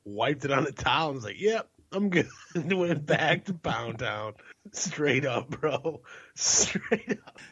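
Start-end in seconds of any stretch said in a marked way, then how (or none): 1.4-4.3 high-pitched, laughing delivery. Real, not acted. Laughing through his own punchline. 4.7-7.4 high, laughing affirmation. Real, not acted. Laughing after his own punchline.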